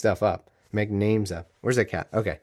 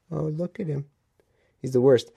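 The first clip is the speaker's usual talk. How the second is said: cooing at the cat; 4 semitones higher than usual. Real, not acted. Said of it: "holding the cat".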